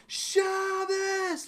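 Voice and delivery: high, bursting shout; excited shout. Retold or imitated. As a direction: burst in calling it out. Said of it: "He charges in the room and he goes".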